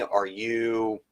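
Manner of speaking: ordinary talk, nothing marked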